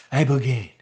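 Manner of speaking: a hint of a presidential impression; Donald Trump. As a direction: lightly imitate the president on IBOGAINE. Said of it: "I sounded like Trump".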